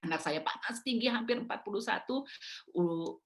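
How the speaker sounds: ordinary talk, nothing marked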